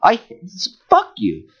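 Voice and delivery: ordinary delivery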